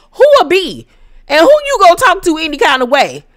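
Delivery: loud, high indignant comeback; hammed-up affronted challenge. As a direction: fire back in mock outrage. Acted comeback to being insulted.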